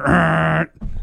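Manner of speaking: another long buzzing raspberry; imitated loud fart. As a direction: mimic a really loud fart. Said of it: "really loud one".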